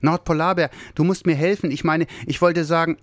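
little Santa rushing in, high and breathless; breathless excitement. Acted. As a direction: blurt it out out of breath. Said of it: "völlig außer Atem".

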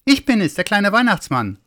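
high, eager little figure's voice; little Santa announcing himself from outside; little Santa calling. Acted. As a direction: call back up high. Calls back into the cave.

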